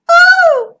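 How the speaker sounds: very high, loud squeak. A narrator's reading. Zara sees a lizard pop out, squeaking. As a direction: let out a startled squeal. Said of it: "squeaked Zara".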